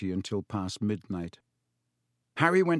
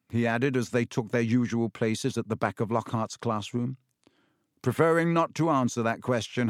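ordinary delivery, nothing marked

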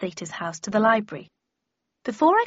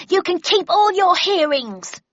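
high, slow, louder raging yell; furious shout; 10 semitones higher, 7 dB louder than usual. A narrator's reading. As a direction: bellow it in fury. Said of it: "shouted a second voice".

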